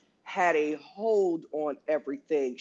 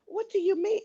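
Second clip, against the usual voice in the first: much higher, sweet and innocent; 12 semitones higher than usual. Retold or imitated. Coworker mocks her office voice, playing dumb, pitched up high. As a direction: play dumb in a bright sugary pitch.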